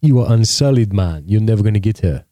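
jeering in mock Jamaican; Jamaican accent. Campfire mates mocking the lovestruck soldier, mock Jamaican accent. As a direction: put on a Jamaican accent to scoff. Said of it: "I don't know why he's talking Jamaican".

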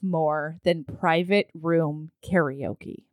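low, slow and dreamy; slow, savoring. Real, not acted. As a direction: linger on every word. Savoring favorite thing.

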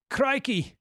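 put-on high exclamation in a botched Scottish accent; attempted Scottish accent. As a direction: try Scottish, land nearer Australian. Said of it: "I was trying to go for the Scot-, Scottish accent".

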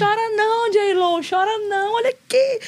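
a hammed-up diva teasing her rival; mock taunting. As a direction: gloat at J-Lo playfully.

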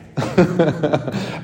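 short amused laugh. Real, not acted. A quick chuckle. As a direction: priest to congregation, laughing at his own complaint.

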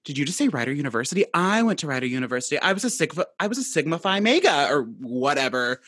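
very fast, gushing mock sorority sister; butting-in sorority girl. Stranger butting in about Rider University, rapid-fire imitation. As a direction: rattle it off like an overeager stranger. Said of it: "whips around and went".

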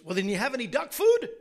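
high, loud, triumphant duck question; spring the punchline as the pushy duck; cheeky duck punchline. Acted. Duck delivers punchline to owner.

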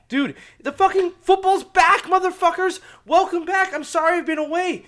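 high, loud fan hollering; excited outburst. Real, not acted. Host welcoming listeners back to football, excited holler. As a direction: burst out with high-pitched excitement.

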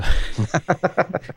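bright high burst of laughter. Real, not acted. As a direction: laugh out high at your own joke. Laughs at own joke.

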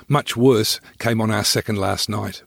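ordinary delivery, nothing marked

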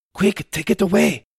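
high, urgent whisper-quick voice; hurried henchman. Acted. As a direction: rush it out like a nervous crook. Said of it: "said the other".

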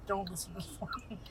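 unvoiced whispered aside. Real, not acted. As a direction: whisper it like a secret.

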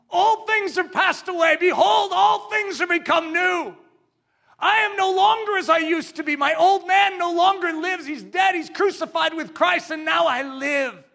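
high, loud shout from the pulpit; preaching shout. Acted. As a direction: declare it with full force. Triumphant testimony shouted.

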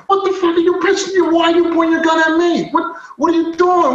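high-pitched indignant retold yell; angry yelling. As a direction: act out yelling at armed officers. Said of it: "I'm yelling at them".

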